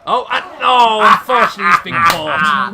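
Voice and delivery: very loud, high-pitched call; big loud play-by-play outburst; excited dismay. Real, not acted. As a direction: cry out as the move goes wrong. Commentator reacting to wrestler caught.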